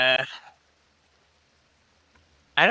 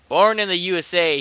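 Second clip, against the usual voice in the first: proud; 5 semitones higher, 5 dB louder than usual. A narrator's reading. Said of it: "he answered proudly".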